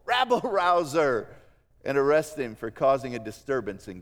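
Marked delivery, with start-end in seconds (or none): 0.0-1.3 loud, raised accusation; mock yell. Preacher voicing leaders yelling at Jesus. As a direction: fling the words like an angry crowd. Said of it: "they would yell".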